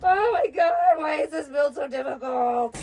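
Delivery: high, wailing frustration; real exasperated wail; meltdown. Real, not acted. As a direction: cry out in despair at the build. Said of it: "I just had a full-blown meltdown".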